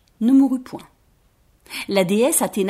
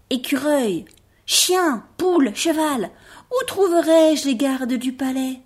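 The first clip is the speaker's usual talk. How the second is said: high, eager little rabbit voice; a small rabbit asking hopefully; 5 semitones higher, slower than usual. Acted. Rabbit asks the animals.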